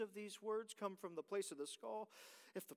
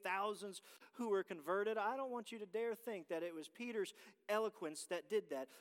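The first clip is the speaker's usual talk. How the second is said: ordinary talk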